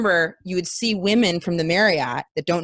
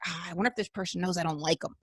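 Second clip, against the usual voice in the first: much softer, fast, private musing; quietly thinking aloud, too fast; paranoid inner thought; 10 dB quieter, faster than usual. Retold or imitated.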